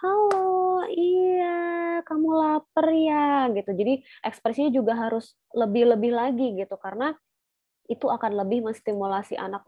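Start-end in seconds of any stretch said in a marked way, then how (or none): 0.0-3.5 put-on high, bright sing-song to a baby; higher, cheerful voice for an infant; cheerful baby talk. Shown as a demonstration. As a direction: coo at the baby happily. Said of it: "harus riang kayak".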